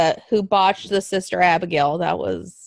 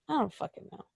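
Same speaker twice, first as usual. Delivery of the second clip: normal talking voice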